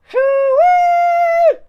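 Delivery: very high, very loud pig squeal; screamed squeal. A narrator's reading. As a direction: scream a pig squeal with all your force. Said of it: "Barnaby screamed".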